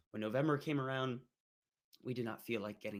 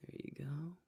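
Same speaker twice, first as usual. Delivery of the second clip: whisper; 3 semitones higher, 7 dB quieter than usual. Real, not acted. Showing the illustration.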